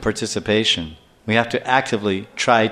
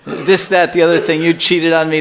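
higher-pitched tirade through laughter; laughing mock tirade; 4 semitones higher, 3 dB louder than usual. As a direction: rattle off a wife's accusations, amused. Laughingly acting out wife's tirade.

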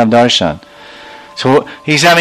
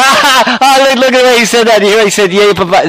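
high jeering laugh, then pitch easing down; mocking heckler; 9 semitones higher than usual. Onlooker ridiculing how the Brahmin said it, high jeering.

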